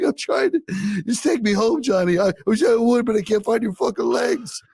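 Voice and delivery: high, strained mock crying; hammed-up Rambo breakdown. Rambo breaks down over his friend, mock sobbing. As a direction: wail it like a parody tearjerker. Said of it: "It's the saddest scene".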